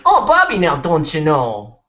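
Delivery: high, slow cartoon mother; sing-song mom voice starting very high and loud; Bobby's mom. Imitating a cartoon mother talking to her son, exaggerated mom voice. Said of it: "the mom's voice is always like".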